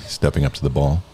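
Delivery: low, soft golf commentator; hushed play-by-play on the green. Acted. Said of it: "use your best golf voice".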